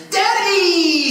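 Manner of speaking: very high, loud, drawn-out squeal; excited little girl. Retold or imitated. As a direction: squeal it like an overjoyed child. Girl interrupts him, acted squeal.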